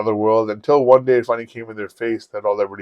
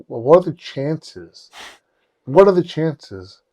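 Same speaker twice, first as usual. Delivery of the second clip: drawn-out, higher-pitched disbelief; incredulous; 5 semitones higher, slower than usual. Retold or imitated. Friend marvelling that it might be the same girl, incredulous. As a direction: stretch it out, marvelling at the coincidence.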